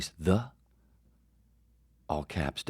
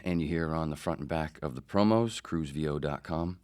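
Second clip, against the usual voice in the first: usual speaking voice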